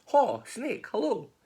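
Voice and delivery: high-pitched calling out; cheery mouse greeting. Acted. Mouse greeting snake, high sing-out call. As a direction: hail a pal in a high voice.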